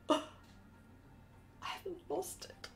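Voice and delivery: hushed, breath-only amazement; awed whisper. Retold or imitated. Artist retelling her realisation on finishing, awed whisper. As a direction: whisper it as if you can't believe it.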